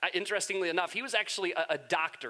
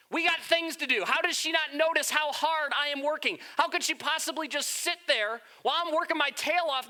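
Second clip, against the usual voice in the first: higher, louder exasperated griping; hammed-up nagging sister, fast at first; mock complaining; 7 semitones higher, 3 dB louder than usual. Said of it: "she's complaining about her sister".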